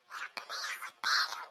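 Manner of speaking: unvoiced, very soft whispered prayer. Child hoping uncle won't notice, whispering. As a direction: whisper it so nobody can hear.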